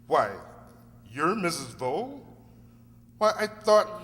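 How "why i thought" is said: high, loud burst of surprise; startled. Acted. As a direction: caught off guard by who she is. Lawyer shocked by Missus Vole.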